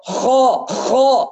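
خاء pushed hard from the throat like a snore; teacher imitating the wrong snoring خاء; snoring kha. Shown as a demonstration. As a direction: force خاء out with a rasping snore. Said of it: "كأنه واحد في عنده شخير".

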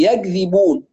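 يكذبون voiced as yag-dhibun; kaf as Egyptian jim. Teacher mimics a common error, kaf turned g. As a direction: turn the k of يكذبون into hard g. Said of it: "من ينطق الكاف كأنها، آه، جيم مصري".

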